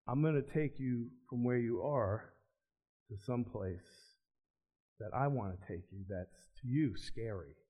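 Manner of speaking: hushed voice of Jesus speaking to him; gentle divine voice. Retold or imitated. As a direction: speak it like a quiet promise.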